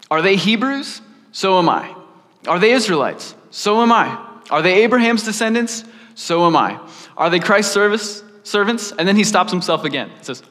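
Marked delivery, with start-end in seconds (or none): 0.1-8.9 punchy question and answer, building higher; boastful scripture reading. A narrator's reading. Paul boasting to the Corinthians, read out with emphatic swagger. Reads Paul's boast aloud.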